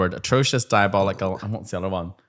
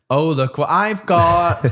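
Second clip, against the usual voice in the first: slow, higher teasing sing-song; mock teasing, drawn out; 6 semitones higher, much slower than usual. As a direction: stretch it out to tease the kids. Teasing class with bin bag.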